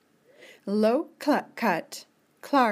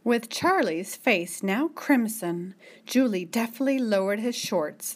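ordinary delivery, nothing marked

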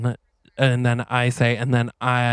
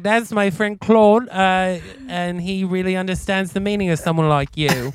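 [name] impression; 7 semitones higher than usual. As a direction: play a bubbly British star, pitched up. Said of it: "And she's like".

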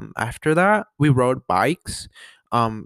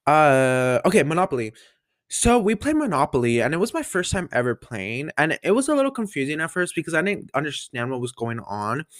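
ordinary delivery

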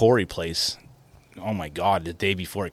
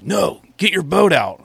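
put-on higher, louder, slow bossy old man; old man's order; 9 semitones higher, 5 dB louder, slower than usual. Old man orders the boat out, higher and slow. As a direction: play the old man ordering him off the lake. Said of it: "he kept coming, like".

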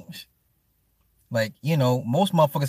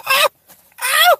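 high, loud hammed-up yelp; cartoonish high ah ah yelp; mock shriek; 7 dB louder than usual. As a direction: squeal like you just got startled. Mock yelp reacting to video.

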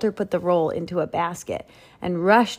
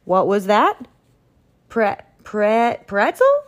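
surprised Duke; 3 semitones higher, 7 dB louder than usual. Acted.

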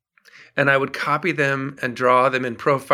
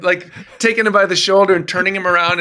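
ordinary delivery

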